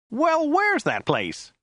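very high, drawn-out whine; Joe's whiny voice. A narrator's reading. Puzzled driver questioning his passenger, very high and whiny. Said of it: "His voice was rather high and whiny".

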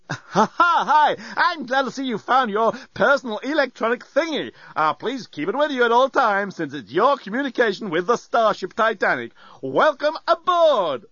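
high-pitched, gushing robot host; effusive robot greeter. A narrator's reading. Talking lamp welcomes passenger aboard, high and effusive. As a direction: greet with over-the-top cheer, drawing out the last words.

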